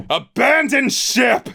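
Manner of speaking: captain bellowing to his crew through the storm; bellowed order. Acted. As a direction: roar the order across the deck. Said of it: "he bellowed".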